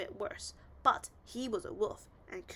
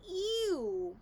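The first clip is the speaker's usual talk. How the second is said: disgusted ew; 8 semitones higher, 5 dB louder than usual. A narrator's reading. Disgust at trash.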